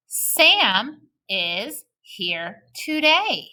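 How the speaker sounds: Sam is here today said very slowly; slow choral reading. Shown as a demonstration. Teacher leading kids in reading, slow and clear. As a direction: read it slowly for the class. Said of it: "Let's try this one".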